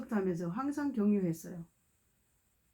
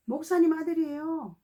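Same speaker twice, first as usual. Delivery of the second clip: bright, higher self-introduction; eager young man introducing himself; 7 semitones higher than usual. Retold or imitated. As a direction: announce it proudly, pitched up. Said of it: "이렇게 하는 거예요".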